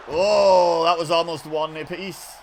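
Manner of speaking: excited exclamation. Real, not acted. Commentator reacting to a pin that was almost a win, excited. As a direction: cry out at the near miss.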